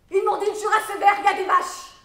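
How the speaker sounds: high, shouted exasperation; loud angry recounting; furious outburst. Acted. Angrily reports what they told her.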